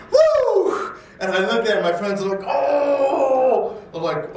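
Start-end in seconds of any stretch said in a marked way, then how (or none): 0.1-1.0 high, loud whoop of triumph; thrilled shout after landing the jump; excited whoop. Retold or imitated. 2.4-3.8 high, loud amazed shout; excited cry. Retold or imitated. Friends reacting to the jump.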